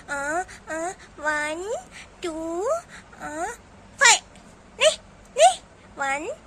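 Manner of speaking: put-on halting, drawn-out childish counting; unsure little girl. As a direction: stumble through the numbers like a scared child. Child recites numbers haltingly. (अटक-अटक कर गिनती सुनाती बच्ची)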